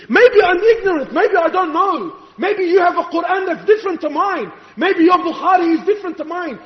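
urgent, exasperated preacher's appeal; impassioned preaching. Real, not acted. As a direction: challenge the congregation with rising passion. Preaches at full force.

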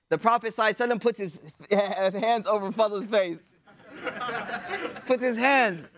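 words broken by real laughter; chuckling through the punchline; laughing through it. Real, not acted. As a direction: tell it while cracking up. Funny Fadl story told laughing.

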